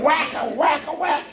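high, playful imitated whacks; mock whip sounds. Retelling whip blows, laughing.

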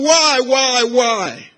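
high, insistent repeated cry; retold shouting. Preacher retelling a boy's cry to God, high shout. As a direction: keep the pitch up, crying out each word. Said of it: "while he was shouting".

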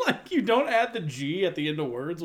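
usual speaking voice

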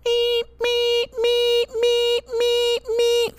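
very high, squeaky repeated "meep"; smoke alarm beeps. Retold or imitated. The cake burns and the alarm goes off, imitated in a very high squeak. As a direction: squeal it like a smoke detector going off.

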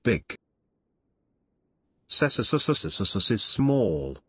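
slow stuttering robot glitch. Acted. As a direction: machine stuck repeating a sound.

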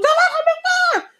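high, loud frantic plea; panicked high cry. Retold or imitated. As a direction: shriek it from behind the bed.

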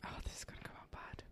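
very quiet whispered aside; whispered dread. Real, not acted. Player worries to themself about the next accent, whispering. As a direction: whisper it under your breath.

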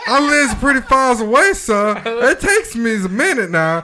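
high, loud, slow pleading servant voice; mock pleading servant. Teasing his friend, begging the boss in a high hammed-up voice.

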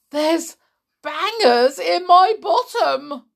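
high, loud, wailing complaints; outraged yelling. A narrator's reading. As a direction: rant loudly as an outraged old woman. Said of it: "she yelled".

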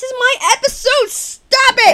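loud, high protesting yell; playful protest shout. Acted.

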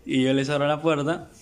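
ordinary talk

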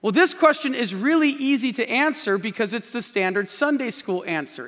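ordinary talk

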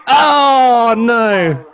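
high, loud "oh no"; dismayed high cry. Real, not acted. Reacting to the voicemail.

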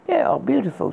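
high, lightly coy praise. Acted. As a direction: say it coyly, inviting. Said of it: "she said, coyly".

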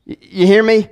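normal talking voice

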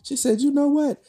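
put-on very high, thin old lady. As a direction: squeak it out like an elderly woman. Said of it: "start using my old lady voice".

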